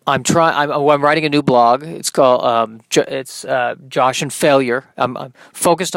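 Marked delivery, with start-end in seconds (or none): none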